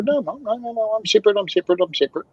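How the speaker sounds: playing people who insist they're separate; lightly voiced indignant crowd; protesting people. People protesting being called same.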